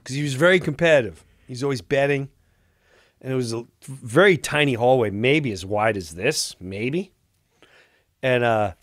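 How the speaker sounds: ordinary talk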